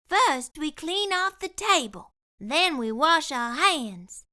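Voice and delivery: lightly voiced bossy cook; Curly Locks voice. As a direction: lay down the rules kindly. Curly Locks instructs the scare.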